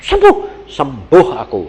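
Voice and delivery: mock cry of astonished healing; imitated shouting. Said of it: "papa saya teriak-teriak".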